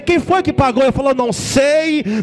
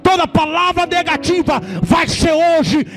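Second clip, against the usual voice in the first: fiery preaching; 3 semitones higher than usual. Acted. A pastor declares victory to the church, fervent preaching. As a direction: preach it over them with rising fire.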